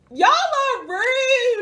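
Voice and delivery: high, loud, drawn-out wail of protest; mock indignation. Real, not acted. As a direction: wail it slowly, playfully offended. Mock protest at teasing over accent.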